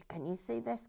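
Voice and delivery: ordinary delivery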